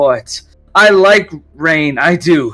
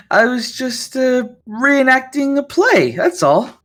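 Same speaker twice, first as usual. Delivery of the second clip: higher, halting, with a nervous laugh; nervous backpedal; 7 semitones higher than usual. Acted. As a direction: scramble to cover it as a joke. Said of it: "her entire demeanor changes".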